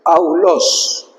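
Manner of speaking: Aulos con diptongo au claro; Aulos pronunciado. Shown as a demonstration. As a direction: di Aulos separando a-u ligado. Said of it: "Así se pronuncia". (pronuncia Aulos, au como en castellano)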